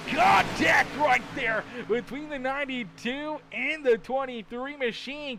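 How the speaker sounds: thrilled breathless race call; excited crash call. Acted. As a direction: hammer home the contact. Calling the crash live.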